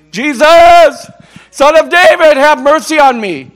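loud, high desperate cry. Acted. Blind beggar crying out to Jesus, loud high plea. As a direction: call out like a blind beggar begging. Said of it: "He kept crying out".

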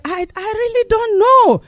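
flustered answer. Retold or imitated. Servant answering King Saul, high and flustered. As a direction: answer helplessly.

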